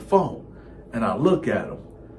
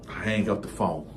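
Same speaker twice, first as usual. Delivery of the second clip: normal talking voice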